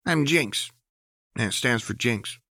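face him down and mean it; defiant Jinx. A narrator's reading. Jinx declares herself.

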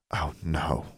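soft, dismayed moan. A narrator's reading. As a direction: let it sink out quietly in dismay. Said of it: "Forbearance moaned".

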